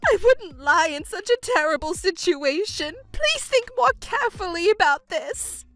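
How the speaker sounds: distraught wailing.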